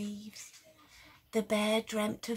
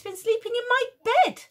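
much higher, loud shocked shriek; shrieking mum; 13 semitones higher, 9 dB louder than usual. Acted. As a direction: shriek it in alarm. Mummy person shrieks.

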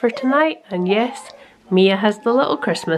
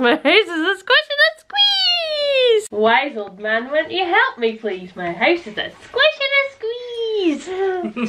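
put-on high comic old lady; 8 semitones higher than usual.

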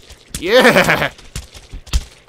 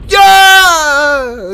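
long, high, loud yeah; wild yell; 9 semitones higher, 9 dB louder than usual. Real, not acted. Player in a fight with pirates, wild yell. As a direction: let out a drawn-out holler. Yelling during game fight.

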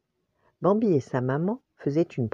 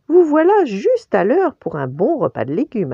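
high, lilting mother's announcement; maman enjouée; 8 semitones higher, 7 dB louder than usual. A narrator's reading. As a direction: announce dinner high and loud, singsong. La maman accueille les petits, enjouée. (voix chantante de maman)

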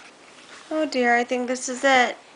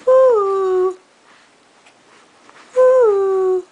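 high, louder drawn-out "ooh"; cooing "ooh"; 6 semitones higher, 6 dB louder than usual. Shown as a demonstration. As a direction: coo a long, high "ooh" to coax a baby. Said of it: "Do a little".

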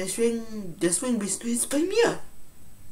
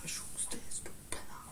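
whispering; 16 dB quieter than usual. Acted. Whispered insult after scuffle.

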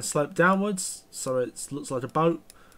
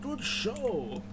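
high, hammed-up posh British "Good show"; a clumsy try at a plummy English gent, pitched high; mock posh English accent; 8 semitones higher, 5 dB quieter than usual. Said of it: "I can't really do a posh accent".

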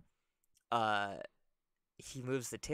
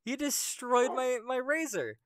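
outraged monkey; 14 semitones higher, 8 dB louder than usual. Acted. Monkey blaming the old man for his razor, outraged. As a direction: wail the accusation, pitched way up. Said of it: "he goes".

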